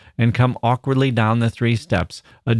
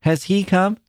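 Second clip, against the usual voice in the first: put-on much higher, anxious child's question; small child asking; 9 semitones higher than usual. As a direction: ask it like a worried little girl. Child asks about father.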